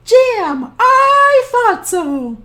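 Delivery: put-on high, sweet old woman; cheerful high granny voice; old grandmother voice. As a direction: play the kindly old granny talking to her guest. Baba Brena voice.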